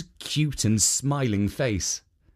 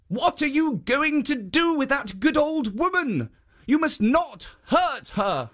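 higher, firm guardian; raised stern-kind guardian; protective statue voice; 13 semitones higher than usual. Acted. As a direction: stand up for the old woman. Jitso protects the woman.

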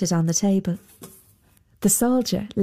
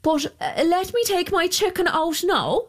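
higher woman's voice; 11 semitones higher than usual. Acted. Sibby speaks, higher.

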